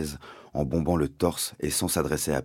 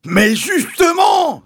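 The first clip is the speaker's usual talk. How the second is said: slow, stretched high shriek; Mister Hazel crying out; 10 dB louder, much slower than usual. A narrator's reading. Landowner cutting in on the sergeant, long high shout. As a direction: yell it, dragging out the word. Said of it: "s'écria mister Hazel".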